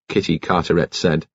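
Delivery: usual speaking voice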